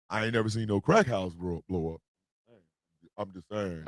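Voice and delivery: very low, quiet mumble; muttered aside. Real, not acted. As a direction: say it under your breath, pitched right down. Low aside about crack houses.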